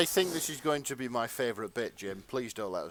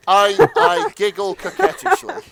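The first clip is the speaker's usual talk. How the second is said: high, loud, breaking into laughter; cracking up; 12 semitones higher, 9 dB louder than usual. Real, not acted. Narrator fails to read the line straight, helpless laughter. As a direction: lose composure trying to read seriously. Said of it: "my sort of brief erotica voice".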